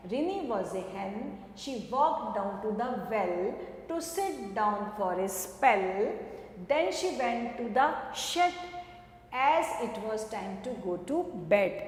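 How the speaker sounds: gentle, unhurried poem reading; poem recitation. Acted. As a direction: read the little poem slowly to children. Teacher recites a short poem.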